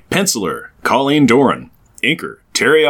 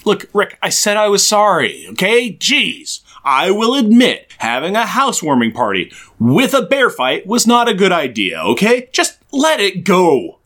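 defensive whining; 8 semitones higher than usual. Acted. Mock defensive apology.

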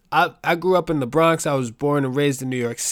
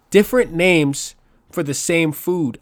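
ordinary talk, nothing marked

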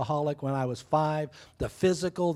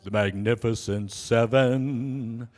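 dramatic title; 5 semitones lower, much slower than usual. Acted. Grandchildren announcement, movie title.

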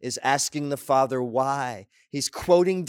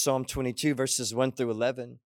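ordinary talk, nothing marked

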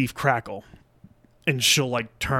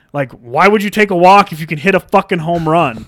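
louder, higher heckling of a batter; retold trash talk; 5 semitones higher, 6 dB louder than usual. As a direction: needle him, imitating your own taunt. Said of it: "started talking shit to him".

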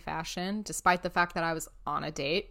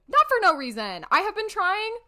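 indignant protest; 13 semitones higher, 7 dB louder than usual. Real, not acted. Defends self, indignant.

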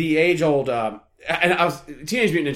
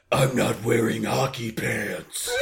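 Batman growl; 4 semitones lower, 3 dB quieter, slower than usual. Pretend. Quoting Batman scene.

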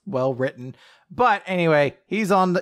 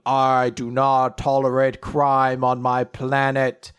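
slow, booming proclamation; measured, imperious announcement; voice calling from beam; 4 dB louder, slower than usual. Acted. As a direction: declare it slowly, like a voice from the sky. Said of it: "the voice kinda calls out from this beam".

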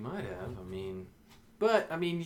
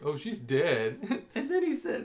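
laughing; 7 semitones higher, slower than usual. Retold or imitated. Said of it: "he was just like".

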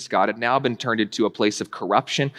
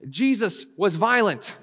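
ordinary talk

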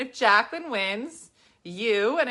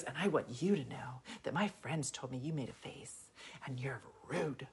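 hushed whisper; 8 semitones lower, 14 dB quieter than usual. Retold or imitated. Heckler whispering her complaint to the comedian during the show. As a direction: whisper it, barely voiced.

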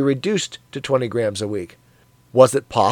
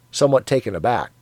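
ordinary delivery, nothing marked

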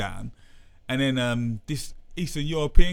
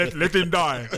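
imitating Creed; 2 semitones higher, 3 dB louder than usual. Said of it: "you know Creed when he goes".

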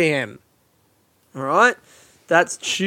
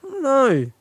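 yawning; 11 semitones higher than usual. Real, not acted. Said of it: "yawning so much".

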